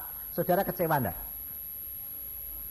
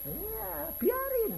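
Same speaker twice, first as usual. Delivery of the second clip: defiant shrug; 3 dB quieter, much slower than usual. Retold or imitated. Believer answers mockers.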